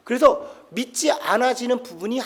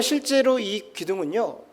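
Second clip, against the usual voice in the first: ordinary talk, nothing marked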